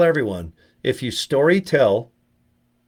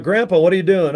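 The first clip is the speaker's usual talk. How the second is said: mock exasperated call; 6 semitones higher, 6 dB louder than usual. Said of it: "I'm like".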